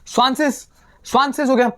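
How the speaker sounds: स्वांसेस said as tsvan-tsich; German pronunciation. Shown as a demonstration. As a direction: model स्वांसेस for the students. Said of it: "ये word क्या बन गया". (स्वांसेस का German उच्चारण)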